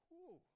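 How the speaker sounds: high, loud swooping whoosh. Retold or imitated. Preacher showing fear suddenly leaving, high whoosh. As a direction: let it swoop up like something flying off. Imitates fear rushing away.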